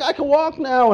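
high, loud exclamation; excited amazement. Acted. Healed man who couldn't walk, excited amazement. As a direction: burst out with astonished joy.

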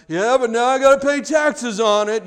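high, loud mock-gloomy grumble; Debbie Downer. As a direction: whine like someone who finds the bad in everything. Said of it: "a Debbie Downer that's like".

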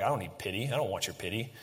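low, soft dismissal; muted proud refusal. Acted. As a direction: voice a proud refusal under your breath. Congregation's refusal of pity voiced.

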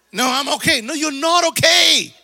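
a believer insisting a sick friend is not okay; mock argument. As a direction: push back harder each line. Acted quarrel about being sick.

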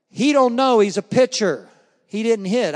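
loud, high, scoffing put-down; mocking shout. Girl loudly mocking a boy pitcher, scoffing shout. [name] mocks Jackie's hitting.

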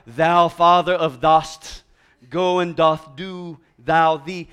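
slow, loud mock King James prayer; Shakespearean prayer voice. Preacher imitating his dad's King James prayers, mock Shakespearean. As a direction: imitate a dad praying in grand Old English, drawn out. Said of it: "in prayer he's Shakespeare".

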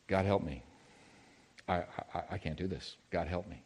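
soft, low, pleading under the breath; quiet desperate prayer. Retold or imitated. Man panicking on plane quietly begs God for help. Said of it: "I quietly cried out".

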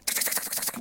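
rapid tongue-clicking typing noise; fast staccato t-k mouth clicks; imitated phone tapping. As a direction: mimic frantic thumbs with quick ticks. Imitates campers busy on phones.

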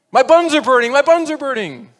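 very loud, high comic wail; parroted mother's alarm. Acted. Little boy parrots his mother to the teacher, mock-panicked.

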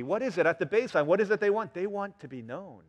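ordinary talk

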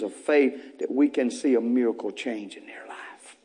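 hushed, breathy question; whisper. Real, not acted. Preacher whispering to congregation, hushed question. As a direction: lean in and whisper it.

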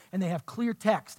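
ordinary talk, nothing marked

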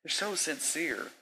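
high, soft and slow; mock their sincerity sweetly. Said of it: "mocking objector".

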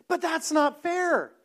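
mock outraged objection; indignant protest. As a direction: protest that it isn't fair. Retold objection to grace.

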